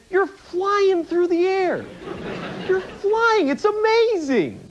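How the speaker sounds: high, slow, awestruck; amazed. Real, not acted. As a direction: marvel at the miracle of flight. Marveling at flight.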